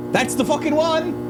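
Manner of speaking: high, triumphant exclamation; excited discovery. Real, not acted. Sound editor finding the exact room tone, excited high cry. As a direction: pitch it way up, thrilled to have found it.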